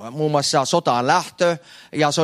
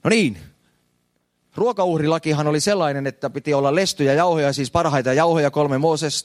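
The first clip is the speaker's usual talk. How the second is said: ordinary delivery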